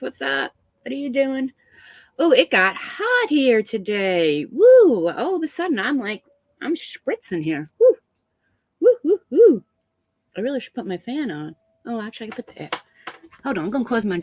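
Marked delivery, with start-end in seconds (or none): 4.5-5.1 overheated "woo!"; loud, high-pitched whoop. Real, not acted. Reacting to getting hot. 8.8-9.6 string of short high whoops; overheated "woo, woo, woo"; high loud whoops. Real, not acted. Hot, spritzing in the room.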